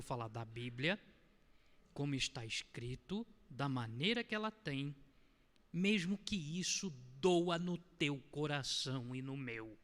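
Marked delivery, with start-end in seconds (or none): none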